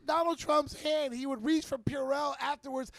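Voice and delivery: heated slow rant; loud, slow emphatic rant. Real, not acted. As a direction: drive it home hard. Ranting about Trump.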